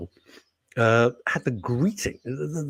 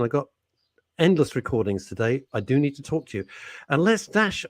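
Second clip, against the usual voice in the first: usual speaking voice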